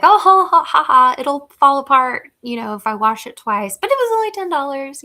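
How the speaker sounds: high, loud laughing airhead voice; careless shopper. Retold or imitated. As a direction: toss it off with a shrill giggle. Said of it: "overheard conversations like that".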